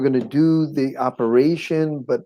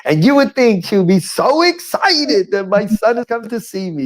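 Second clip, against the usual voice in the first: ordinary talk, nothing marked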